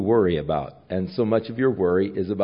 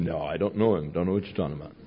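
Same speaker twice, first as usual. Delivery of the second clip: low, soft, evasive mumble; nervous denial; 5 semitones lower, 7 dB quieter, faster than usual. Acted. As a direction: brush her off under your breath. Peter denies to the girl.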